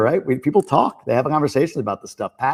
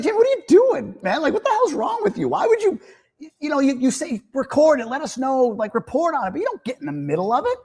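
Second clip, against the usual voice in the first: incredulous friend; 14 semitones higher than usual. Retold or imitated. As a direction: play a friend scolding Jamie in disbelief, pitched way up. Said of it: "You'd be like".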